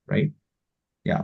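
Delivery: ordinary delivery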